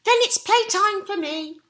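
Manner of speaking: very high, loud "Oh, yes"; squeaky pig. Acted. Horace answering Percy, very high squeal. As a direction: squeal it happily. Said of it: "Horace said".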